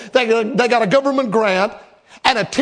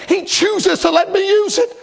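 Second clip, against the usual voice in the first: higher, rising on "use"; higher take; 6 semitones higher than usual. Shown as a demonstration. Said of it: "I'm gonna say that again".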